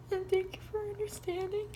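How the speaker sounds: high, softly tearful voice; choked-up and high-pitched; holding back tears. Real, not acted. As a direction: thank them while fighting back real tears. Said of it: "try not to cry".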